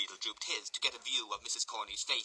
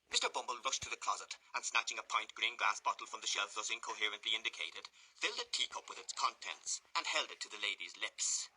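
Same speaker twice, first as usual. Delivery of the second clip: ordinary delivery, nothing marked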